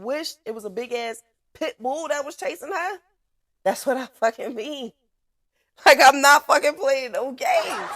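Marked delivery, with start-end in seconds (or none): 5.9-7.9 laughing. Real, not acted. Laughing at her own story.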